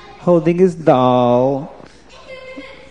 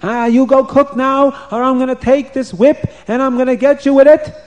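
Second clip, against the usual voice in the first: mean queen yelling; 9 semitones higher than usual. Acted. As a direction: scream a threat with the whip. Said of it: "she starts screaming and yelling".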